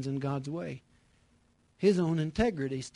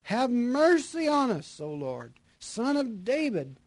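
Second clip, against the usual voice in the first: raised, slow plea for mercy; calling cry; 7 semitones higher, 4 dB louder than usual. Acted. Blind men calling out to Jesus as he passes. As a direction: call to him from the roadside. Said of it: "cried out".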